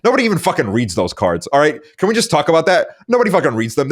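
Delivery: ordinary talk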